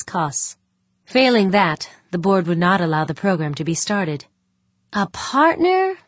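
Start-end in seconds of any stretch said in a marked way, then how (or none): 4.9-6.0 high, drawn-out incredulous question; grousing. A narrator's reading. Board member balking at having to find a partner, grousing. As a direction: grouse it with the pitch leaping up. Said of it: "Pancho groused".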